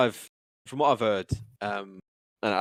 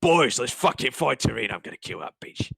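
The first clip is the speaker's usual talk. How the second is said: gruff thug. Acted. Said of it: "you hear a gruff voice like".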